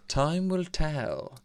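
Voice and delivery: unhurried fortune teller's pronouncement; cool as a cucumber. Acted. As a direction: stretch it out, utterly unruffled. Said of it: "All cool as a cucumber".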